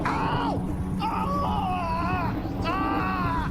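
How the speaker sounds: high, long wails; pained wailing scream. Real, not acted. Player caught straight after gloating, pained high wail. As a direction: wail high as the hits land. Hit right after taunting.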